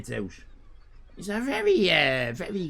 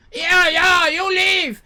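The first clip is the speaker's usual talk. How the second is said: high, loud dismissive shout; angry owner; 13 semitones higher, 10 dB louder, slower than usual. Retold or imitated. Owner yelling at departing customers. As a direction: shout them out the door. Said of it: "owner retorts".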